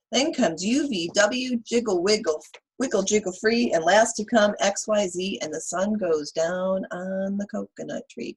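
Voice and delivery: put-on lively read-aloud for kids; reading to children. Teacher reading Chicka Chicka Boom Boom to children, lively. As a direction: read the rhyme to the class with bounce.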